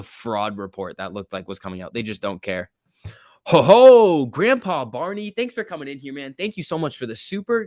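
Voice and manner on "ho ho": booming ho ho. Acted. Greeting a viewer's super chat.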